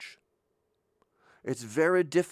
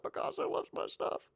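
awed whisper; 6 dB quieter than usual. Retold or imitated. Max Jacob's thrill voiced.

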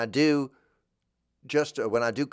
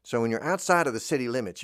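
ordinary talk

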